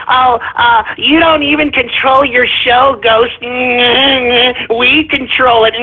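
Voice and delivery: sneering imitation of online critics; mocking chat voice. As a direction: imitate whiny chat hecklers with nasty little laughs. Mimics the chat members taunting him.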